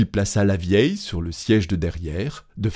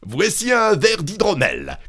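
high-pitched call to someone hard of hearing; 11 semitones higher than usual. A narrator's reading. Innkeeper offering mead to the deaf old woman, calling out. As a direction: cry it out clearly, pitched up. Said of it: "cria-t-il à la femme morte".

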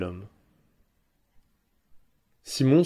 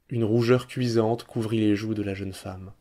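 usual speaking voice

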